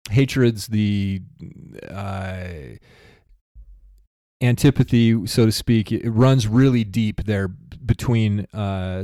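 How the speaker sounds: ordinary talk, nothing marked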